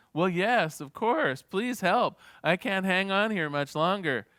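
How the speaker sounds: high, loud urgent pleading; acted desperation. Man hanging from a cliff begs God, desperately. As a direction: beg for rescue, hammed up for a joke.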